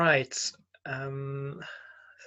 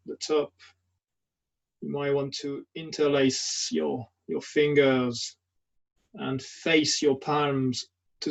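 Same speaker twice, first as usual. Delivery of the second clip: guided meditation; 4 dB quieter than usual. Acted. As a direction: guide them gently through a standing body scan, leaving long silences. Leads a standing meditation.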